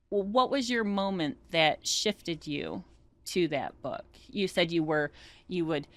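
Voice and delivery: ordinary talk, nothing marked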